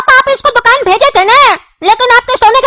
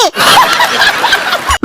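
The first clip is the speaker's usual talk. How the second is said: a high, jeering cackle; mocking laugh; 12 semitones higher, 3 dB quieter than usual. Boy mocks the neighbour with a jeering laugh. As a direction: laugh right in his face.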